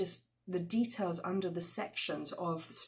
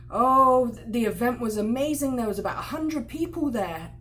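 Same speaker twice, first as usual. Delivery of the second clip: louder, excited bragging; boastful exaggeration; 3 semitones higher, 8 dB louder than usual. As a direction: hype up the story for the guests. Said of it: "the guy or the girl is saying".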